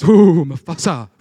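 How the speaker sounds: loud, slow, drawn-out mock dread on Mufasa; "Ooh, Mufasa" in a loud, stretched, spooked hyena voice; hyena shivering at the name. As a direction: ham up a cartoon hyena's shudder. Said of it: "and the other guy's".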